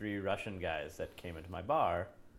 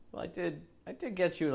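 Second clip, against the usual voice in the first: mock customer; 9 semitones higher than usual. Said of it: "it's always like".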